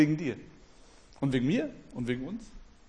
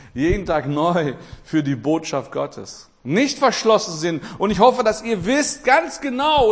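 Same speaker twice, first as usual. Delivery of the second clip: climbing higher and louder, pleading with the congregation; fervent preaching; 4 semitones higher, 4 dB louder than usual. Acted. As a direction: preach it out, urging them to stay open. Preaching rising to full force. (eindringliche, laute Predigt)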